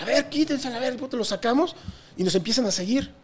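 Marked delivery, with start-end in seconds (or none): 0.0-0.9 calling out. Retold or imitated. Pushing through neonazis in bathroom.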